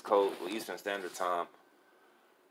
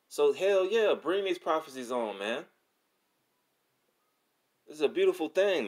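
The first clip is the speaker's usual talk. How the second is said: high, exultant preaching; real excitement, pitch leaping up; excited; 7 semitones higher, 4 dB louder than usual. Real, not acted. Preacher cheering on the coming prophecies, excited. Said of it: "I'm a little bit excited".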